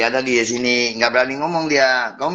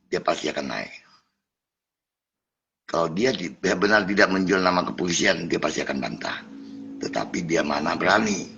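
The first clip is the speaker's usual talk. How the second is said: dropped low and soft, almost to himself; quiet aside; 4 semitones lower, 6 dB quieter than usual. Real, not acted. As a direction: confide it softly to the viewers, ending in a whisper. Aside to viewers about the scammer. (bisikan pelan ke penonton)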